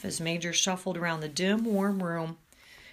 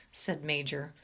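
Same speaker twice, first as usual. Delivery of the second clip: plain reading voice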